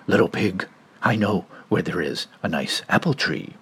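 low, sly wolf voice. Acted. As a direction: lure him softly and low.

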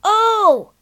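startled shout; cry out. Acted. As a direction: Peter suddenly realising the danger. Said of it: "he cried out".

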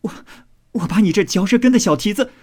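high, rapid mock scolding; laughing scolding. A narrator's reading. Maid going after her teaser with crab, playful high scolding. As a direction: scold fast and high, in play. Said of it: "口内笑骂".

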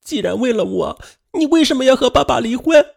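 put-on high, hurt child shouting; yelling. A narrator's reading. Daughter yelling at her mother, high hurt child. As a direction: hurl the accusations at mom. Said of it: "雪莉突然大叫".

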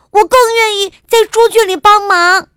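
high, loud defiant princess; shouting. A narrator's reading. Princess refusing the king's last chance, shouting. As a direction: yell a flat refusal in a high child's voice. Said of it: "伊莎喊道".

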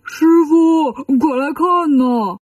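drawn-out summoning call. A narrator's reading. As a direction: call master over to look, stretching the words. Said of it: "把国王放在地上喊".

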